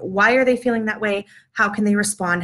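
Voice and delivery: ordinary talk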